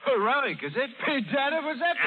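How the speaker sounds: mocking laugh. Pirate mocks the colonel.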